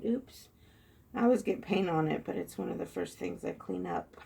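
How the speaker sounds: ordinary talk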